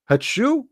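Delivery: high sneeze sound; mock sneeze.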